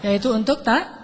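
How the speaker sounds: usual speaking voice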